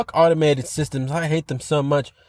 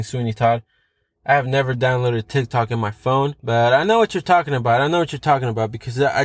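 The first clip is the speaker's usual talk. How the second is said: ordinary talk, nothing marked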